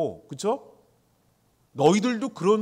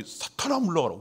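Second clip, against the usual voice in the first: stern rebuke; 2 semitones higher than usual. Retold or imitated. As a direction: cast him out with authority. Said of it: "갑자기". (꾸짖는 호령)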